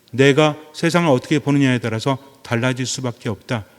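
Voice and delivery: normal talking voice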